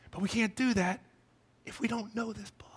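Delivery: very soft, hushed pulpit warning; hushed emphasis. Real, not acted. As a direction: drop to a near-whisper. Preacher drops voice for the point.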